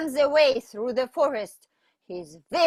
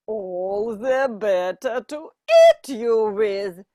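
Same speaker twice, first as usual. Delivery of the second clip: louder, menacing wolf voice; bare the teeth in the voice; wolf menace; 8 dB louder than usual. Acted. Wolf threat.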